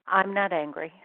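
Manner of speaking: half-hearted angry denial in an argument; mild mock anger. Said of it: "I'm having an argument with my significant other and I say".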